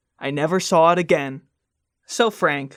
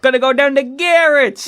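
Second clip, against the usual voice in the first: put-on Chicago accent; 5 semitones higher, 4 dB louder than usual. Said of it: "Is that a Chicago accent?".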